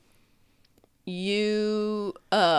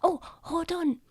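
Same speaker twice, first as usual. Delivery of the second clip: high small voice; hushed little child; 10 semitones higher, 3 dB quieter than usual. Acted. Child pausing to fetch something, high voice. Child whispering before rummaging inside.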